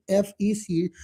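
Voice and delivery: usual speaking voice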